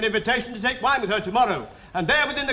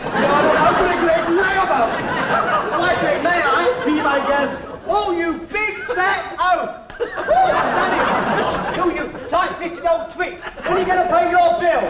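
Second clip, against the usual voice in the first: louder, higher, laughing abuse; gleeful insults; 4 semitones higher, 4 dB louder than usual. Acted. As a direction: hurl the insults with glee. Tailor insults the impostor.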